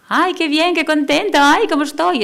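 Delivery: high, loud, sing-song fake delight; theatrical fake cheer. Shown as a demonstration. Teacher greeting kids with exaggerated fake joy. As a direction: overdo the cheer like a sugary teacher. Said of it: "no tiene que ser teatralizada".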